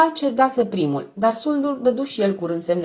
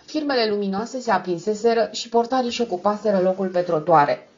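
usual speaking voice

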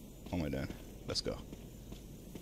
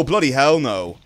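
loud, higher "bloody" punched in panic; alarmed outcry; 6 semitones higher, 13 dB louder than usual. Real, not acted. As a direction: shout it as the monster lunges. Enemy appears in horror game.

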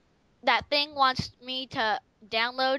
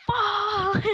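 comic outburst; 7 semitones higher than usual. Retold or imitated. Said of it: "he's like".

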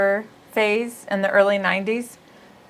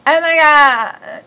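drawn-out, higher, louder bad English accent; attempted English accent; 7 semitones higher, 6 dB louder, much slower than usual. Shown as a demonstration. Host showing co-host a failed English accent. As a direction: try an English accent and land on valley girl. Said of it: "if I can even do the English accent".

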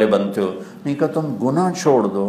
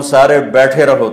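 louder, punched order to sit; loud command; 3 semitones higher, 7 dB louder than usual. Acted. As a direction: bark it out to the crowd. Preacher voicing a command. (زور دار حکم)

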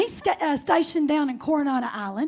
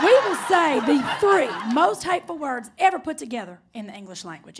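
usual speaking voice

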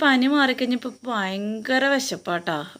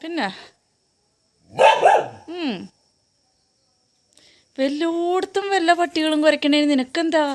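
scolding; 8 semitones higher, 3 dB louder than usual. Real, not acted. Scolding the barking dog.

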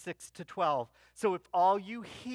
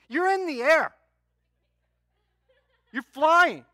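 normal talking voice

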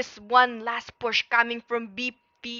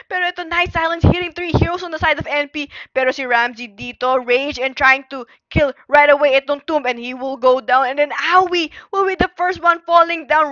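fast, loud, high excited casting; live play-by-play; 4 semitones higher, 8 dB louder than usual. Acted. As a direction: call the teamfight at full speed.

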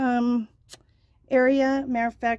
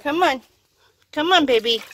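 calling a dog; 4 semitones higher, 6 dB louder than usual. Real, not acted.

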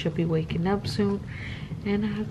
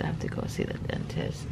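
whispering; 9 dB quieter than usual. Real, not acted. Early morning, kids nearby.

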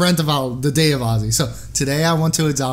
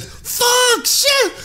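very high, squawked swearing; retold cursing outburst. Nurse cursing as the needle slips, high mock yelp. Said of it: "She said".

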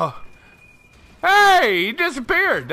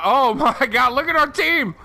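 ordinary delivery

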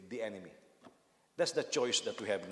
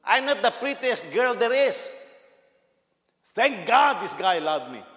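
daughter's voice; 9 semitones higher, 11 dB louder, slower than usual. Retold or imitated.

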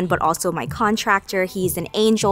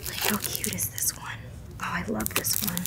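very soft, hushed delight; whispering; 12 dB quieter than usual. Real, not acted. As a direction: murmur it privately in a public place. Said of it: "Usually I'm whispering out in public".

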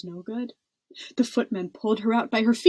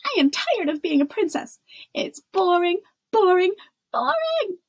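high, loud fed-up complaint; loud shout; 9 semitones higher, 5 dB louder than usual. A narrator's reading. Princess announcing she is bored of being one, shouting. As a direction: complain at full voice, climbing higher and louder. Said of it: "shouted in such a loud voice".

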